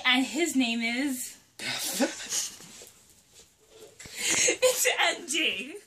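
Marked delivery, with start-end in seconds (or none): 1.5-5.7 laughing. Real, not acted. Laughs introducing her brother.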